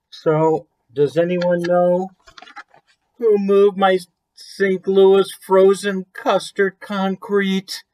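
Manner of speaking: teasing read-aloud question; slow storybook question. A narrator's reading. Reading title question to kids.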